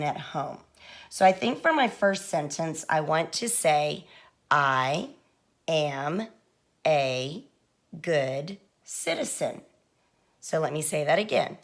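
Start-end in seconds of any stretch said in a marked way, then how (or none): 4.5-9.6 one word at a time, long pauses between; word-by-word dictation. Shown as a demonstration. Teacher modelling a first sentence for young writers, slow word-by-word dictation. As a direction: draw out each word separately, slowly.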